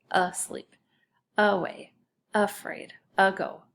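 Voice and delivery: each word of "Asleep, away, afraid, ago" opened with a clear "uh"; schwa A. Shown as a demonstration. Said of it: "sounds like a short U".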